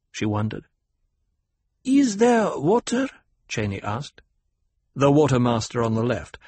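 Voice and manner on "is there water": put-on high, slow girlish question; a girl priestess asking solemnly; young girl voice. A narrator's reading.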